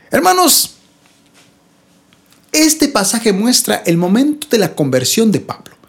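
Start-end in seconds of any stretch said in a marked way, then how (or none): none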